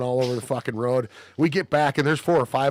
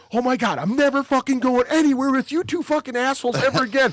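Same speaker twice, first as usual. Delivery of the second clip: high, loud shrill-free scolding rant; hammed-up furious outburst; mock angry wife; 11 semitones higher, 4 dB louder than usual. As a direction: yell it like a fed-up girlfriend. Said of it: "his old lady is sitting there going".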